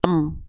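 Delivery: "Em" closing softly on a held m; consonant sound of mim. Shown as a demonstration. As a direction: let "Em" end on closed lips. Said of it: "Bunyi konsonan dari huruf mim".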